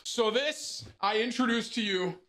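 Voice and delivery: slow, grand product announcement, pitched high; presenter reveal. Acted. Proud unboxing host presenting the console to viewers, grand and slow. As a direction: unveil it like a showroom host.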